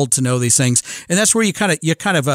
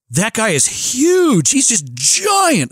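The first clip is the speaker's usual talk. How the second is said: put-on higher, slow, wide-eyed kid voice; amazed son; 7 semitones higher, much slower than usual. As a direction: marvel at how big he was.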